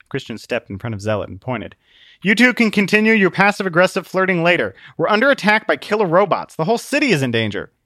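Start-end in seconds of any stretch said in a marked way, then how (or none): 2.2-7.7 urgent warning. Acted.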